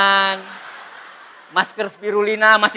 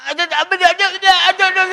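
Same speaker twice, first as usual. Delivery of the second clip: mock flustered bluff; 8 semitones higher, faster than usual. Recruiter bluffing an answer.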